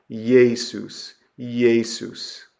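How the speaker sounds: "Jesus" begun with a y, YAY-soos; yod sound. Shown as a demonstration. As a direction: say "Jesus" as two syllables from a y glide. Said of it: "add a kind of a y, a yod sound".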